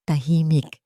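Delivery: usual speaking voice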